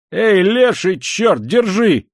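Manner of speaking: high, loud, drawn-out shout; yelling. A narrator's reading. Man bursting out of a flat downstairs, high yell. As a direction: holler a curse after someone. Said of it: "С криком вырвался кто-то".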